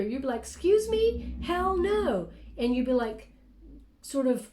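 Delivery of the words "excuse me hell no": mock outraged shout at someone insulting you; imitated scream. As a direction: snap back in outrage, voice jumping high. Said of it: "screaming at them".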